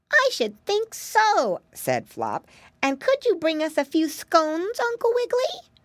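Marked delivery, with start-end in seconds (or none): 0.1-1.6 put-on high, slow, drawn-out piggy child voice; keen piggy boy. 2.8-5.7 put-on very high little piggy child voice; hopeful piggy boy.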